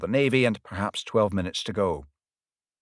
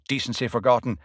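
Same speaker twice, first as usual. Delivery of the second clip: ordinary delivery, nothing marked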